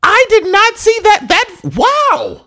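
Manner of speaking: very high, astonished exclamation; real amazement. Real, not acted. Co-host stunned by a listener's voice, amazed. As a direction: squeal it in sheer disbelief.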